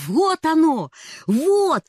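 high, loud and bursting with delight; joyful outburst. A narrator's reading. Girl bursting in on her aunt, excited shout. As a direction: shout it out, thrilled by a new idea. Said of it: "радостно затараторила она".

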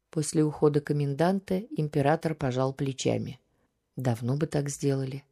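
usual speaking voice

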